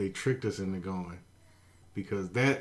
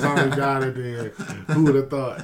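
laughing; 3 semitones higher, 5 dB louder than usual. Real, not acted. Laughing at joke.

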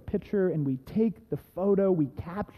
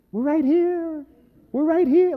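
high, raised call across distance; 10 semitones higher, 6 dB louder than usual. Retold or imitated. Couple calls to screaming lost hikers, high holler. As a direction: holler to be heard over screaming. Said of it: "you couldn't hear us over your screaming".

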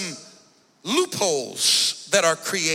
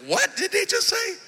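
confused; 12 semitones higher than usual. Retold or imitated. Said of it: "You're like".

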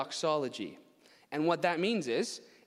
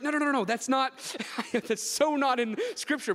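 laughing protest; 10 semitones higher, 5 dB louder than usual. Retold or imitated. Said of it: "he's like".

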